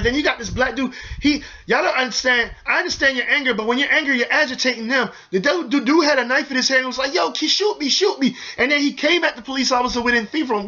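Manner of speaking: ordinary delivery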